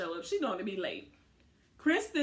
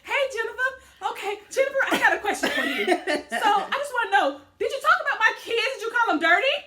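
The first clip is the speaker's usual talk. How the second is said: high, loud mock sweet interrogation through laughter; imitating a high, loud confrontation; 10 semitones higher, 7 dB louder than usual. Recapping Malaysia confronting Jennifer about her kids, high and loud. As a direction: play the furious mom asking sweetly.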